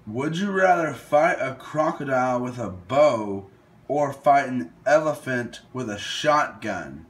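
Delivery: ordinary talk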